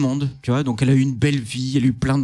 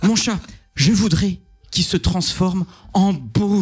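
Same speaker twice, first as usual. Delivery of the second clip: slow wish; 3 semitones higher, slower than usual. Acted. Cinderella's third wish.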